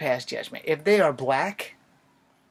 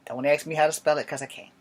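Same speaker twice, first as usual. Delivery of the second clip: ordinary talk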